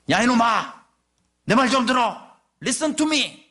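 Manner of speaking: loud, high scolding yell; nagging father. Retold or imitated. Father nags his child to listen, loud high scolding shout. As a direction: bellow it like a fed-up father nagging his son. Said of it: "그게 잔소리처럼 들립니다".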